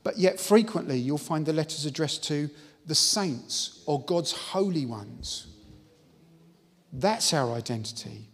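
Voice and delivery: full-force pulpit preaching; fervent preaching. Acted. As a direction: proclaim it to the congregation.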